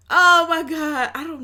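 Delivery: a big stunned cry; shocked. Real, not acted. Reacting to [name]'s voice in loud disbelief.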